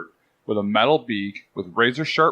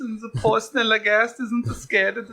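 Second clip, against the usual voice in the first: high-pitched mock German accent; hammed-up, creepy German, pitched way up; 11 semitones higher than usual. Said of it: "like, high-pitched German accent".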